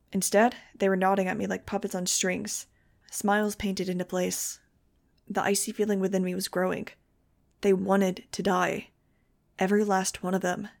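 ordinary delivery

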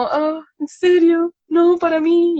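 high, slow, amazed little voice; the crying clerk, surprised by a chocolate, protests warmly; touched surprise. Retold or imitated. Surprised and touched, high and slow.

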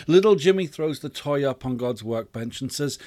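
normal talking voice

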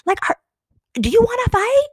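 high-pitched half-yell, retold; kinda yelling. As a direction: call it out to be heard over loud party music. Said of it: "kinda yelling a little bit".

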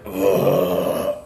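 put-on short pained groan, like an old dog getting up; dog groan imitation. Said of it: "You can hear her kinda go like".

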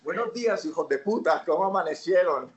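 high, laughing Medellín accent, mock polite; mock Medellín greeting. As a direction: order coffee in a cheeky, hammed-up Medellín accent. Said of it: "O sea".

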